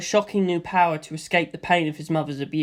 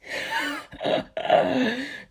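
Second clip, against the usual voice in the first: laugh; 8 semitones higher, 5 dB quieter than usual. A narrator's reading. Stephen laughs.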